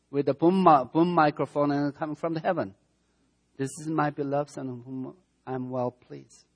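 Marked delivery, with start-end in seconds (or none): 3.6-6.5 softer, reverent declaration, gently trailing away; hushed voice of the Father. Acted. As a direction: speak it quietly, as a sacred blessing. Retelling the Father's voice again.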